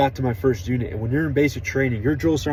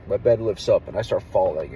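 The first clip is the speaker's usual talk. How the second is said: usual speaking voice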